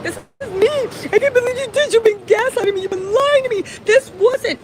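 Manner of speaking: high-pitched, tearful protest, acted out; retold tearful pleading, pitched well up; imitated sobbing. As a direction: mimic a woman crying as she accuses. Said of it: "She's crying, she's sobbing. She's like".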